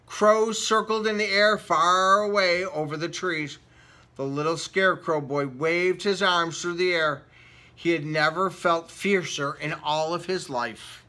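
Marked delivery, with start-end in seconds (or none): none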